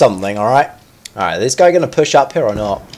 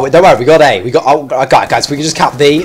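ordinary talk

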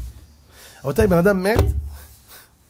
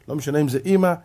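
ordinary talk, nothing marked

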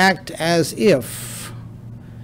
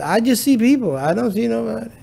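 higher-pitched mimicry of a pious relative; mock someone claiming innocence in a higher voice; imitating family member; 7 semitones higher than usual. Relative denying any temptation to the family, mimicked. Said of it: "family member that says".

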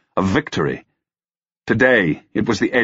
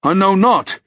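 high, louder desperate denial; urgent exclamation of innocence; exclaiming; 13 semitones higher, 5 dB louder than usual. A narrator's reading. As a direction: cry it out in protest. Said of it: "Ruck exclaimed".

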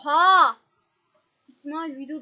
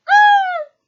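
calling out; 14 semitones higher, 13 dB louder than usual. Real, not acted. Calling out to friend.

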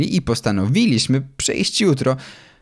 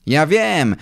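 calling out; 6 semitones higher, 3 dB louder than usual. A narrator's reading. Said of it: "zawołał Kosma".

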